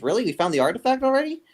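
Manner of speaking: high, surprised question. Acted. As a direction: sound amazed it's found already. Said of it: "He says".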